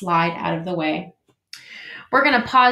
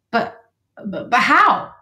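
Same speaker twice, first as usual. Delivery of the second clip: stuttering in disbelief; sputter, too amazed to speak; astonished stammer; 3 semitones higher, 4 dB louder than usual. Acted. Beaver astonished by fire.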